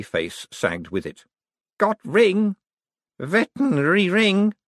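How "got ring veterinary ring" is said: high, delirious mumbling; mumbled. A narrator's reading. Sagging sick man claiming his ring, mumbled. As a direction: mumble it as the lucidity drains away. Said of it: "he mumbled".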